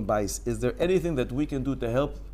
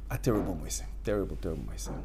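ominous hush; 10 semitones lower, 11 dB quieter, much faster than usual. Acted. Dramatic story opening.

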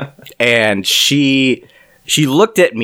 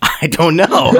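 higher, amused delivery; real laughing answer; 6 semitones higher, slower than usual. Real, not acted. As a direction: say it breaking into laughter. Joking answer amid laughter.

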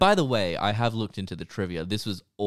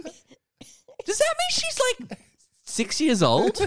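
incredulous; 5 dB louder, much slower than usual. Real, not acted. Shocked at a short lifespan.

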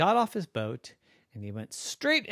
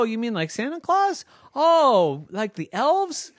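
puzzled villagers; 8 semitones higher, 5 dB louder than usual. Acted. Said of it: "said the villagers".

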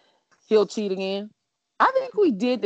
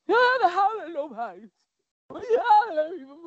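higher, teary voice breaking into a cry; imitating her crying; 9 semitones higher than usual. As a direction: replay her childhood crying, pitched up and tearful. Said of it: "I'd be like".

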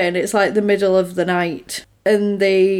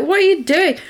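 higher-pitched, mock-startled question; retold startled outcry, pitched up; baffled protest; 8 semitones higher than usual. Man to woman who just kissed him, mock-baffled.

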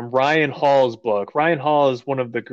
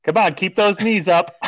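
barking gym coach; 7 semitones higher than usual. Pretend. Coaching runner through hard minute.

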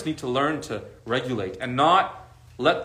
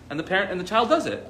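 normal talking voice

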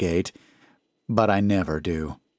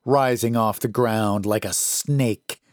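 plain reading voice

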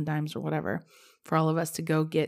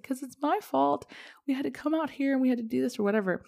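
put-on much higher and fast, a child blaming herself; upset child; 8 semitones higher than usual. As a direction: blurt it out like a distressed little kid. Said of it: "I was like".

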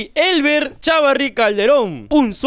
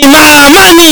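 Mamani blasted out much louder; stress on Mamani; 5 semitones higher, 15 dB louder, slower than usual. Real, not acted. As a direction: shout only the word Mamani, keep the rest normal. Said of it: "¿Ves? Hay como una diferencia". (énfasis a gritos en Mamani)

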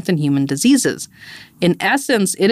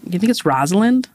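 normal talking voice

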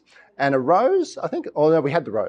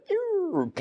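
squeaky, much higher, soft mock wobble of a tree; tree's squeal; 7 dB quieter than usual. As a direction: let out a tiny high squeak like a tree bending. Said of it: "the tree doesn't go".

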